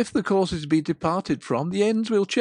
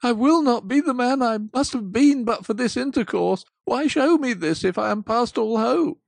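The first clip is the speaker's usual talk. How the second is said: louder, higher desperate plea; loud pleading; 5 semitones higher, 7 dB louder than usual. Acted. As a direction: Scrooge begging the spirit for hope. Scrooge begs the ghost.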